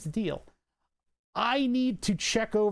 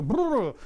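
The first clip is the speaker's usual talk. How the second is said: distorted buzz imitation; 5 semitones higher, 4 dB louder than usual. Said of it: "and it distorts".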